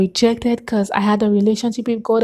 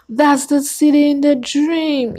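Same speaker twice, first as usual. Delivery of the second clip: awed realization; 6 semitones higher than usual. Retold or imitated. Recognizes dream city in Bible.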